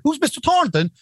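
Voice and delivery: high, mock clueless question. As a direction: play dumb about the name. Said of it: "We're like".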